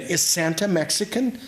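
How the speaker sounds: put-on high, loud child's voice asking; a small girl blurting it out for the room to hear; little girl's loud question. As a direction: pipe it up like a curious six-year-old. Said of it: "she shakes it again".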